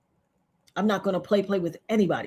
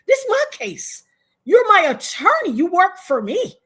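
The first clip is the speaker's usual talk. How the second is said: high, emphatic dressing-down; fiery retold telling-off, pitched up; indignant arguing; 7 semitones higher, 5 dB louder than usual. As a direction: put the attorney firmly in their place. Acting out argument with her attorney.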